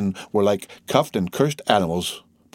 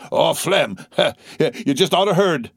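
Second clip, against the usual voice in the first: high, excited with a laugh; bursting to share the news; eager cry; 9 semitones higher, 3 dB louder, slower than usual. A narrator's reading. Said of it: "cried one eagerly".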